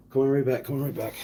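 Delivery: talking while blowing nose; blowing nose mid-sentence. Real, not acted. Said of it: "I'm blowing my nose real quick".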